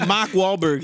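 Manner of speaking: slow, mocking Boston accent; exaggerated Boston imitation. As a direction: drag out a dropped-r Boston accent. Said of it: "The Boston accent is one of".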